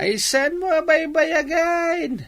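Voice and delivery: hammed-up old father, slow and high; teasing old father. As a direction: draw it out high like a doting old man.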